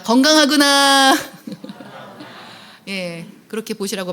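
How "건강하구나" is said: drawn out, louder and higher; model phrase for repeating. Shown as a demonstration. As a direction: say it slowly for the audience to repeat. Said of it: "자, 따라합니다".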